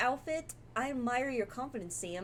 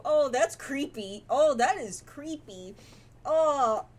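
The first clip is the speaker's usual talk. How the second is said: creeped out; 5 semitones higher, 9 dB louder, slower than usual. Real, not acted. Reacting to creepy figure.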